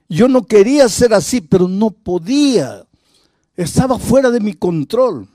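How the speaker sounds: confesión impotente, aguda y fuerte; súbelo como quien confiesa desesperado; desesperación actuada. Retold or imitated. Confesión de un hombre sin control. (desesperado, imitando una confesión angustiada)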